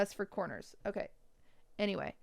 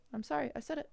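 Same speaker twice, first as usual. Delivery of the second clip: sheepish mock apology; 4 semitones higher, 3 dB quieter, faster than usual. Real, not acted. Apologizing playfully for harsh critique.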